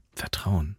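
low, soft husky murmur; hushed, low repetition; hoarse murmur. A narrator's reading. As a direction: murmur it hoarsely, barely voiced. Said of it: "murmelte er heiser".

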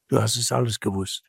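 quick, subdued pronouncement; low-key, grave reproach; sober verdict. Acted. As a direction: state it quietly as a final judgement. Same words as sober verdict.

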